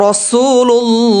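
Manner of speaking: রাসূলুল্লাহ with a long drawn রা-সূ; elongated vowel. Shown as a demonstration. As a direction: stretch the রসু in রাসূলুল্লাহ. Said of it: "রসু, এখানে টান হবে".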